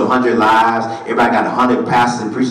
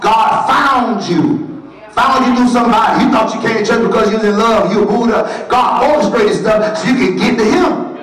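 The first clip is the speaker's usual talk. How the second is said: full-force preaching; 9 semitones higher than usual. Acted. Preacher shouting at the congregation, full force.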